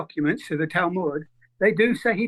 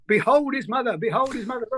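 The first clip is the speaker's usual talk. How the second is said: ordinary talk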